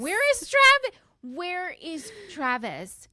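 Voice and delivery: high-pitched, theatrical demand; exaggerated wail of a question; mock calling out. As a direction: call out for the missing boys. Calling out for absent boys.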